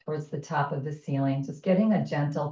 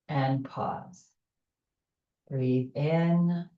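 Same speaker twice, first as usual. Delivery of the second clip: calm, unhurried breath guidance; guided meditation. Acted. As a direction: ease them through each breath.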